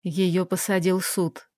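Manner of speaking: usual speaking voice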